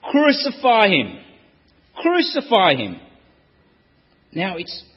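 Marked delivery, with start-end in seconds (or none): 0.1-3.0 loud, high yell of "Crucify him!"; crowd shout. Acted. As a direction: roar it like a furious mob.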